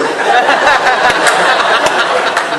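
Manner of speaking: big burst of high laughter; loud laughter. Real, not acted. Remembering the ski prank, loud laughter.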